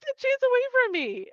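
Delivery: hammed-up angry parting shot; furious outburst. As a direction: shove him away with the words. Leia furious, storming off.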